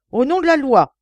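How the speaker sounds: loud, high official shout; shouted command. A narrator's reading. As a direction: bellow it in the name of the law. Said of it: "cria-t-il une troisième fois".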